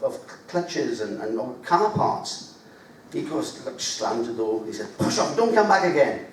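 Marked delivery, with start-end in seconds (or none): 5.0-6.3 mock angry. Mandela slams door.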